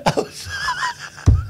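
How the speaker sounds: high laughing sorry; laughing apology. Real, not acted. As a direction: giggle through the apology.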